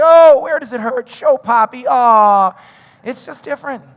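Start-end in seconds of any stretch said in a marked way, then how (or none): 0.0-2.5 doting comfort. Retold or imitated. Poppy comforting his hurt little daughter, doting and loud. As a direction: fuss over a little girl's scrape.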